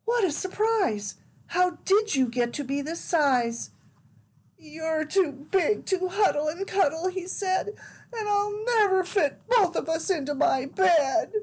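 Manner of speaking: put-on high, wondering child's voice; small boy, a little tearful; little boy Eddie. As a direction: Eddie amazed at the giant teddy. Eddie speaking to giant bear.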